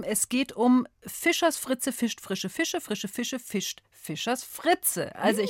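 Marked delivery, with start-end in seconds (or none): 1.1-5.1 slow, soft, careful tongue twister read; said slowly. Shown as a demonstration. Host reads the tongue twister slowly to callers. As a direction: spell it out slowly and softly for the caller. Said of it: "ich sage es mal langsam".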